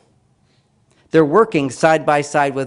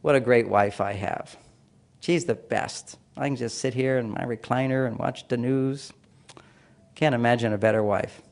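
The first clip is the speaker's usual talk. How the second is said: contented thoughts; 4 semitones lower, 7 dB quieter than usual. Retold or imitated. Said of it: "I'm thinking the whole time".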